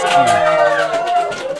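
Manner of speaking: drawn out and loud, like a cheering crowd. A narrator's reading. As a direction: let it swell into a roar. Narrator mimicking the giants' cheer.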